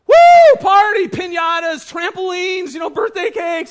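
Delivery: high, loud squeals of glee; whoop, then stretch out each party treat; excited kids. Retold or imitated. Kids in the car hearing about a party, overjoyed.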